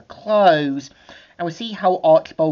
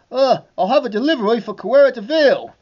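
higher, fast delivery man voice; 8 semitones higher, faster than usual. Acted. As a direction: rattle off the delivery line. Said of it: "two delivery people saying".

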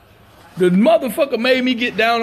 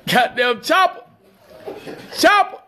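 high mock call to the police dog; mimicking cop calling dog; 5 semitones higher than usual. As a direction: call the dog's name up high like the officer. Retelling the officer calling the dog.

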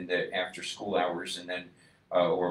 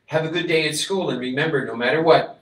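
raised, earnest call to his son; father's farewell; 5 semitones higher, 8 dB louder than usual. A narrator's reading. Father sending his son off to school, calling out. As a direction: say it big and warm as a parting promise.

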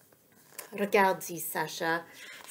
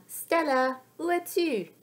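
higher searching call; 7 semitones higher than usual. A narrator's reading. Sacha asking where Stella is, calling out. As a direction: call out as if she can't be found. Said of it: "crie Sacha".

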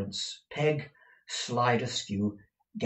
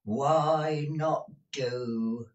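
very slow, higher-pitched retort; retorting old woman; 6 semitones higher, much slower than usual. A narrator's reading. Deaf Peg challenging her master, drawn-out retort. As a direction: stretch out the challenge. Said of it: "retorted Peg".

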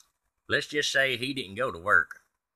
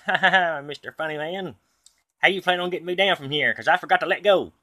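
sarcastic mock laugh; 6 semitones higher, 5 dB louder than usual. Stuck to the ceiling, mocking.

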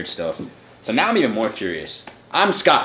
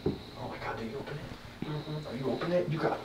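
whispering; 5 semitones higher, 14 dB quieter than usual. Real, not acted. Reacting to the smell.

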